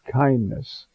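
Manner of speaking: normal talking voice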